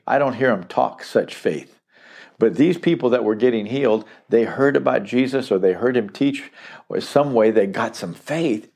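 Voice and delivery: ordinary delivery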